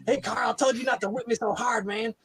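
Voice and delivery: high, whiny comic complaint; Dave Chappelle impression. Retelling the Chappelle whipping scene to friends in a high whiny voice. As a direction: whine it like a sketch comedian. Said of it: "does very much sound like Dave Chappelle".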